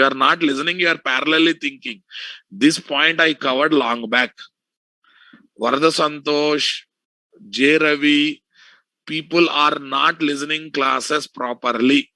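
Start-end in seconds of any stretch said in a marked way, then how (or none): none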